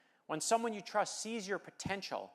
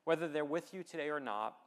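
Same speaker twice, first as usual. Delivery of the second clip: normal talking voice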